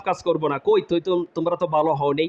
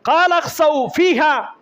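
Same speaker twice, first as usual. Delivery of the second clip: high, emphatic spoken verse; forceful declaiming; 10 semitones higher, 4 dB louder, slower than usual. Acted. Preacher repeats Allah's dismissal to explain it, high forceful declaiming. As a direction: punch each word, no melody.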